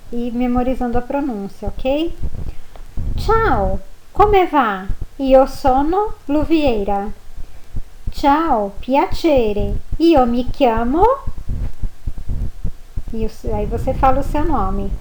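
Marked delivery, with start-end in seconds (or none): 3.2-11.0 "Ciao, come va? Io sono Luviera" read slowly and clearly; model reading. Shown as a demonstration. Teacher reading Italian greetings for pronunciation practice. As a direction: read the dialogue for memorizing. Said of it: "Eu vou ler pra vocês".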